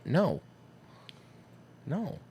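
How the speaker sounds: ordinary talk, nothing marked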